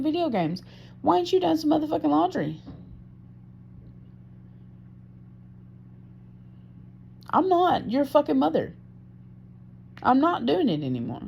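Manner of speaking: high-pitched, exasperated scolding; angry rant. Retold or imitated. Speaker scolding partner over laundry in retelling, angry. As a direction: re-enact a heated telling-off, pitch pushed up.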